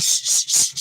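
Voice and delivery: whispered urgent shushing; hushing. Retold or imitated. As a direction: shush them hard under your breath. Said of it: "Kathy like".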